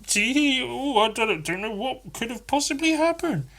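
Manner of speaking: feigning innocence, pitch rising; hammed-up innocent friend; mock innocent denial. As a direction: play dumb and innocent. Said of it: "this look on his face that just says".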